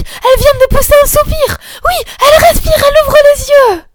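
nearly shouting with happiness; joyful shout. A narrator's reading. Camille sees the child breathe, ecstatic cry. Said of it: "un cri de joie".

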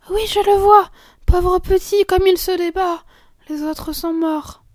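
aiguë et forte, apitoyée; s'exclamer avec pitié; émotion d'enfant. Acted. Fillette voit le hérisson se débattre. (fillette émue qui s'écrie)

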